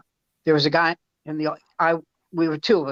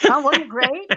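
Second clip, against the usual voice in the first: mock cheerful; 10 semitones higher, 4 dB louder than usual. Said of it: "I go".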